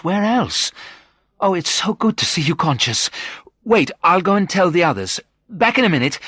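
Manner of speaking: high, delighted woman's voice; excited. A narrator's reading. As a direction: rush out the good news, pitched high. Delighted visitor sees patient conscious.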